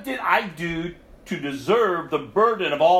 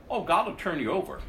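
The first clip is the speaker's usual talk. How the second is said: ordinary talk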